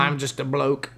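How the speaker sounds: lightly attempted Australian accent; mock Australian bloke accent; bad Australian accent. Shown as a demonstration. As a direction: have a joking go at an Australian accent. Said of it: "do it in an Australian accent".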